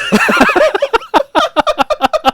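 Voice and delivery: loud high burst of laughter; real delighted shriek of laughter; big laugh. Real, not acted. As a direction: crack up completely at the line. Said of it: "I love that so much".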